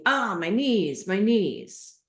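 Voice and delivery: lightly acted moan of discomfort; mock knee complaint.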